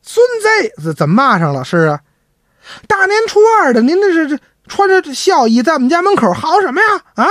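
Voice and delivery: put-on high, indignant old Beijing neighbor; a lightly voiced neighbor scolding. Neighbor scolds at his gate.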